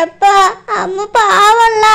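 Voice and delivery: pitiful plea. Acted. Daughter pleads with father to pity her mother, crying.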